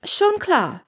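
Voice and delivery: high, groaning reply; groaned, exasperated. A narrator's reading. Granger answers Wendeline's remark with a groan. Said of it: "stöhnte Granger".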